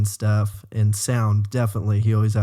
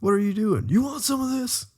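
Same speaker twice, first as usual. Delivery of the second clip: much higher squeaky mock threat; mock tough challenge; 11 semitones higher than usual. Turning on the mom as a joke, squeaky mock challenge. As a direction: square up as a joke.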